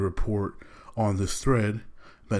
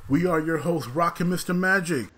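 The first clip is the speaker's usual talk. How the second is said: hyped host welcome, pitched up; podcast intro; 7 semitones higher than usual. Acted. Host welcoming listeners to the podcast, hyped announcer voice. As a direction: open the show like a radio host.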